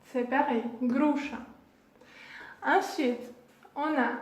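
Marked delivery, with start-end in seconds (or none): 0.8-1.4 "gruša" as GROO-sha; sh as in chat. Shown as a demonstration. Teacher explaining the Russian letter in pear, sh as in chat. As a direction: say "gruša" with its last syllable like French chat. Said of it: "on prononce comme".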